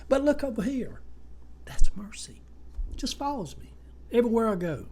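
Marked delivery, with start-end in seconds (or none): 1.7-4.9 whisper. Acted. Confiding aside about mercy.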